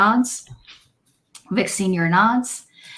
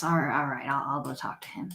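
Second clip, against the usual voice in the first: much softer, fast weary mumble; muttered agreement; 5 semitones lower, 10 dB quieter, much faster than usual. Acted. Father quietly agrees to fetch his boy, muttering. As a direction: grumble agreement and go.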